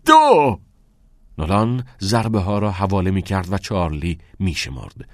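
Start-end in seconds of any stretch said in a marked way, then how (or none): 0.0-0.6 high, strained yell; loud pained count. A narrator's reading. Pupil shaking under the blows, yelling the count. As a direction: shout the count through the pain. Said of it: "از شدت درد به خود لرزید".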